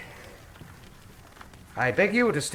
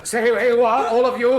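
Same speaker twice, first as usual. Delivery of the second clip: louder, sharp command to freeze; commanding shout; 7 semitones higher, 6 dB louder than usual. Acted. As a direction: stop everyone dead with your voice. Holmes draws gun, commands room.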